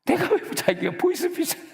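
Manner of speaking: very high, outraged mock voice; shrill mock indignation of the policeman; indignant squeak. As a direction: squeak with offended disbelief.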